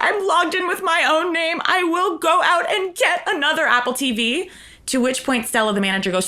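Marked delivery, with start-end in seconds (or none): 0.0-4.5 high, whimpering assistant voice; tearful high voice. Retold or imitated. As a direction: squeak it out near tears. Crying assistant quoted.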